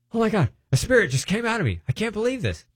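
high, stunned exclaim; amazed. Retold or imitated. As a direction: gasp in disbelief, pitched high. Man amazed spirit came out.

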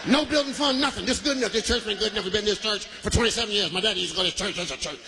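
put-on stubborn old member. A lightly voiced old member turning down the building fund. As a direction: dig in against any change.

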